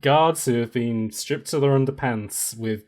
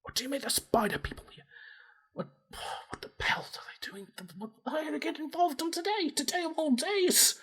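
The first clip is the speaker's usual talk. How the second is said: much higher, softer, rushed panicked whisper; panicked hushed orc; 14 semitones higher, 11 dB quieter, faster than usual. Acted. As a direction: hiss it out fast in a panic. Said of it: "As he's, like".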